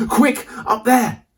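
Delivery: high, urgent shout; urgent high-pitched call to climb. Acted. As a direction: call it out while fleeing the jaguar. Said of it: "Jake shouted".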